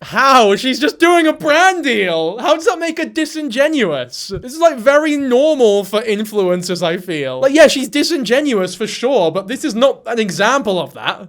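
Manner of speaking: loud, high, incredulous pushback; indignant outburst. Real, not acted. Defending her to the TikToker, loud and outraged. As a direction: shout down the TikToker's claim.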